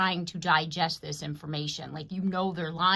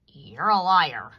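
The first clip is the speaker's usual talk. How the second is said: slowed, much louder call-out; loud accusation; 9 dB louder, slower than usual. Retold or imitated. Reader voicing a confrontation, calling the liar out loud. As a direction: call them a liar to their face, loud and slow. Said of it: "and to say".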